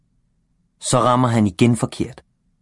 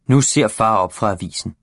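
ordinary delivery

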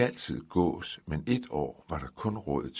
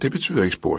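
lightly raised; 4 semitones higher, 11 dB louder, much faster than usual. Acted. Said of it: "sagde manden".

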